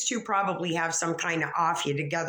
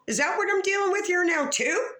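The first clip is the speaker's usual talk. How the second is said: exasperated; 12 semitones higher, 3 dB louder than usual. Real, not acted. Exasperated rant at chat about cats.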